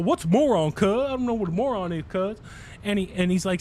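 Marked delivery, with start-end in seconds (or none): 0.0-2.4 imitating a gangbanger. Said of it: "He's all".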